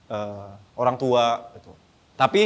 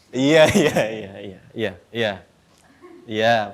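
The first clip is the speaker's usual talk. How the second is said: laughing; 5 semitones lower, 3 dB louder, much slower than usual. Real, not acted. Laughing reply to child's pun.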